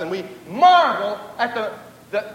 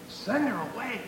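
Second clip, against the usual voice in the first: softer, pressing "send"; hushed plea; 2 semitones higher, 7 dB quieter than usual. Acted. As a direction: Send her away, quiet and urgent. Disciples' plea repeated softly.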